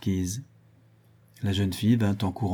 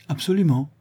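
dry, curt agreement, pitched up; 8 semitones higher, 4 dB louder than usual. A narrator's reading. Blaye agrees with the embarrassed Rueil, curtly. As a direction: say it drily. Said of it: "dit sèchement Blaye".

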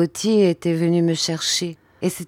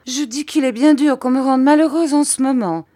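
high, louder pouting complaint; 9 semitones higher, 5 dB louder than usual. Acted. Wife sulking to her husband, high pouting complaint. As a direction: sulk it like a spoilt wife. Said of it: "en faisant une petite moue".

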